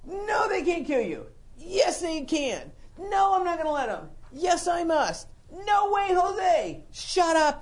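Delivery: high, loud back-and-forth bickering; mock argument. Jesus and Peter bickering, high loud mock quarrel. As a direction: play both sides of a spat. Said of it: "We got a little rebuking going on here".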